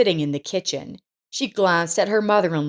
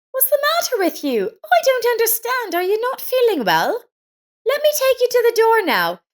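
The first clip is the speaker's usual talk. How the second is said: put-on high, bright-eyed concerned young woman's voice; kind neighbor Lisa. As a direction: play the caring neighbor, worried and eager to help. Lisa worried, offering help.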